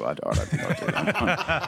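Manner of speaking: laughing. Real, not acted. Self-deprecating joke, laughing.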